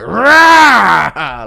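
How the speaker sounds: loud sudden roar; imitated roar. Boy flipping a table, roaring out loud. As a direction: bellow it while flipping a table. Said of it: "flip a table and go".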